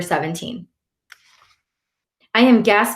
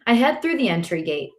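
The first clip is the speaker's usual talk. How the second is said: ordinary delivery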